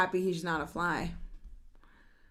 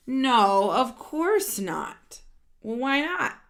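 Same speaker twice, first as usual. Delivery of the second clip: louder, lively read-aloud of a character line; animated storybook voice; 7 dB louder than usual. Acted. Reading a picture book to children, animated and louder. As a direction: voice the characters brightly for the kids.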